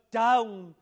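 "down." hit hard and loud; stress on down. Shown as a demonstration. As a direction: punch "down." as a warning. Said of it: "Mark that word".

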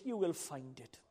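low, soft preacher's aside; hushed repeat. Acted. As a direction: drop right down and say it quietly. Same promise repeated softly after shout.

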